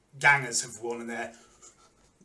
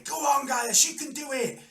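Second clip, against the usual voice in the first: high shouted cheer; cry from the crowd; 13 semitones higher, 5 dB louder than usual. Acted. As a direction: cheer on the fighter from the stands. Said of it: "various cries from the crowd".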